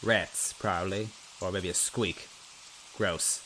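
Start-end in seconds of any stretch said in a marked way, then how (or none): none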